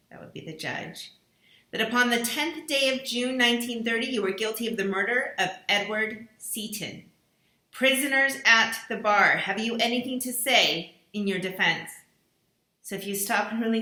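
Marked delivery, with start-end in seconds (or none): 1.7-11.9 accusing recorded voice. Acted. Mysterious voice accusing each dinner guest, stern and solemn. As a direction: pronounce the charges like a judge's sentence.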